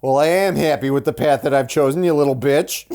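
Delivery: high, loud snide put-down; mock snooty retort. Mocking a movie character, loud snide retort. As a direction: snap it like a bitter rival.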